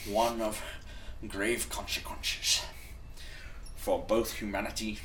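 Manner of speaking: mild Scottish Sean Connery voice; lightly done Sean Connery accent; Sean Connery impression. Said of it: "that was kinda like Sean Connery".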